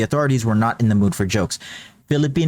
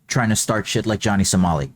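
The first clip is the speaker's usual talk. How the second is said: ordinary delivery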